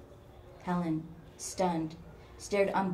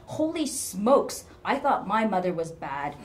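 exasperated amazement; 2 semitones higher, 7 dB louder than usual. A narrator's reading. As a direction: exclaim in disbelief. Coworker exclaims about her mother.

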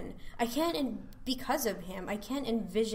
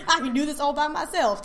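louder, higher sassy voice through laughter; hammed-up diva, laughing at the line; sassy woman voice; 6 semitones higher, 9 dB louder than usual.

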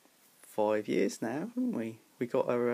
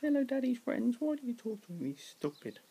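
higher pet voice; voiced cat; 12 semitones higher, 5 dB quieter than usual. Cat greeting Daddy's forum friends in a higher pet voice.

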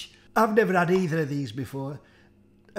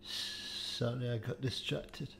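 quiet aside; 5 semitones lower, 13 dB quieter, slower than usual. Real, not acted. Aside while turning away.

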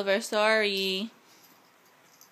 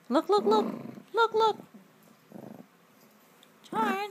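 calling the dog; 11 semitones higher than usual. Real, not acted.

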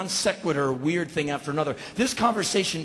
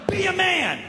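goading challenge; 12 semitones higher than usual. Retold or imitated. Burglar goads the homeowner.

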